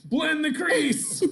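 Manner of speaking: very high, slow, drawn-out holler; football play call. Acted. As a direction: yell it like a quarterback's signal. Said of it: "that sounds like a football term".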